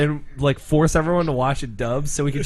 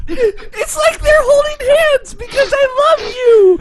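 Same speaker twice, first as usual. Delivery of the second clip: very high, loud mock squeal; shrieky gushing dub heroine; friendship-preaching girl; 5 dB louder than usual. Friend parodying holding hands for friendship, squealed high and loud.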